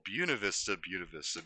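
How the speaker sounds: Buena Vista with "Buena" as "BYOO-nuh"; local pronunciation. Shown as a demonstration. Local explaining the name of their hometown, local pronunciation. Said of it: "That's just how we say it".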